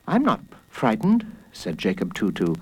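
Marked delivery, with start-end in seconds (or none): 0.1-1.2 put-on high child voice; higher-pitched kid putting on bravery; retreating little boy. A narrator's reading. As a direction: deny fear in a small boy's voice. Boy retreating, denies fear.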